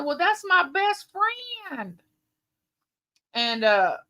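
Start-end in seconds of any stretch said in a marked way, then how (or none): 0.1-2.0 high, slow, a touch of tearful earnestness; retold tearful reply. Answering her husband about her best friend, high and slow. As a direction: say it up high and slow as if still moved. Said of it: "I said".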